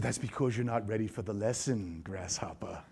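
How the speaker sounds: low, hushed sage teasing a pupil; mock wise master. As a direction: play the old kung-fu master, soft and low. Mock sage answering his daughter.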